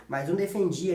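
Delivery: usual speaking voice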